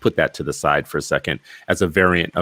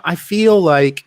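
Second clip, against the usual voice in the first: slow, high and louder, a drawn-out mock "I feel like"; mocking filler talk; 9 semitones higher, 6 dB louder, slower than usual. Podcaster mocks millennial filler words to her co-host, slow and high. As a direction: parody a vague young hire hedging every sentence. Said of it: "filler words and weasel words".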